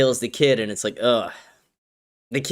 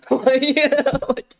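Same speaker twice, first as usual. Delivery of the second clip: laughing; 11 semitones higher, slower than usual. Real, not acted. Laughing at own joke.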